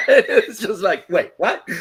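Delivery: high, loud laughter spilling into words; can barely get the words out for laughing; laughing hard. Real, not acted. Cracking up after the joke.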